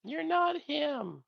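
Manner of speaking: high, slow mocking echo; slow squeaky taunt. Echoing the tease.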